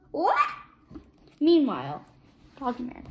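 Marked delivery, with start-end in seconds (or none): 0.1-0.5 sudden very high what; startled. Acted. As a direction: jolt awake confused. Waking up startled.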